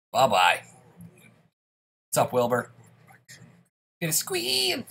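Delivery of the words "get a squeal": high pig squeal voice. Pretend. Mimics the pig enemy's squeal.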